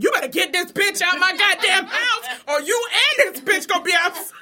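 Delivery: loud, high, laughing threat; laughing shout. Retold or imitated. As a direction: yell it while cracking up.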